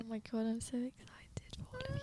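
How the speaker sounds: soft excited whisper. Real, not acted. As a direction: whisper it with delight. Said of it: "Why are we whispering?".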